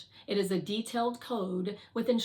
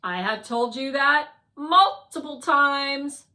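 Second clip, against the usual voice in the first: slow, loud, measured robot; stern Guardian robot; 5 semitones higher, 11 dB louder, slower than usual. Acted. Guardian tells Fly off for asking, loud exasperated firmness. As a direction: firmly remind them, each word louder and apart.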